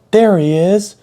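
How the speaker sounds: put-on high, loud kid calling out; accusing shout. Crying kid points at me to the moms, high accusing shout.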